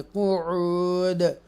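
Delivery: कुऊद drawn out slowly with the final d bounced; slow Quranic recitation of कुऊद with an echoing stop; qalqala on the final d. Shown as a demonstration. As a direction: stretch कुऊद, then let the closing d echo. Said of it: "ऐसे पढ़ेंगे".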